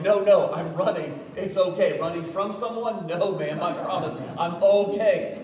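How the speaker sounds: huffing as if mid-run; mock out of breath. Pastor retells reassuring the 911 dispatcher, mock breathless. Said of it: "I probably sounded like I was about to die".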